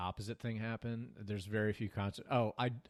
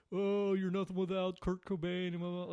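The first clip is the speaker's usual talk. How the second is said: mock heckling yell; 8 semitones higher, 4 dB louder than usual. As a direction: imitate hecklers yelling from behind. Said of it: "there's guys behind me yelling".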